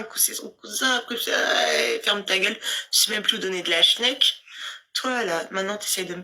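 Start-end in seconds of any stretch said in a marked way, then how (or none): none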